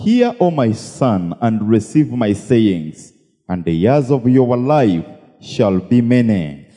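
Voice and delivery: slow, measured Bible reading; unhurried formal recitation of scripture. Acted. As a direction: read the verse out slowly and solemnly. Interpreter reads out scripture.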